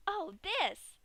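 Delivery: high, loud cheery exclamation; Pinkie's voice. Acted. Pinkie holds up a piece for Dash, high chirpy voice. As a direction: show off the treat like a happy kid. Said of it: "She held up another piece".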